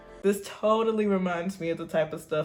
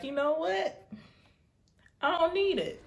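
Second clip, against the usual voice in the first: mock carefree, pitched way up; high pretend voice; 8 semitones higher than usual. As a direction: lift it high and breezy. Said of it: "I'll be like".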